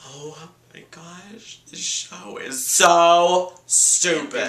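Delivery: slow, drawn-out scoffing; mock scornful drawl. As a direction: sneer it out slowly. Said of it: "And I was like".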